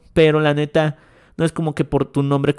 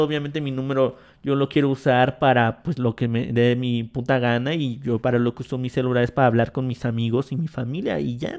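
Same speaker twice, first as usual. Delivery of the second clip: usual speaking voice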